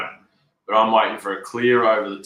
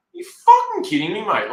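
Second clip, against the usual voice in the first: much higher, louder disbelieving yelp; incredulous outburst; 12 semitones higher, 7 dB louder than usual. Retold or imitated. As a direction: throw out the disbelief at the screen. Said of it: "I was like".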